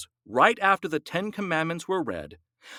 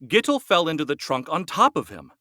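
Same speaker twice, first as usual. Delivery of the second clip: ordinary delivery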